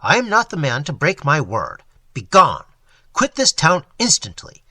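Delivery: loud, menacing warning; menacing judge orders them gone; stern threat. Acted. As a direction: drive them out with fury. Brandon threatens the confederates.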